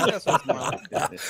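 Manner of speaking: ordinary talk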